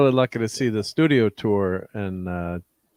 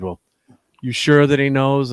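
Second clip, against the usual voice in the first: mildly snide mock challenge, raised pitch; snide tour guide; 4 semitones higher, 5 dB louder than usual. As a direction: needle with a doubting question, a bit louder. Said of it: "kinda being snide".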